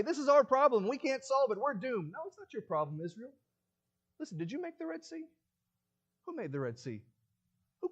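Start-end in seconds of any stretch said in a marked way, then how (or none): 0.0-2.1 higher, louder mock despair of the crowd; mock panic. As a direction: whine it like scared people. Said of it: "The Israelites would say".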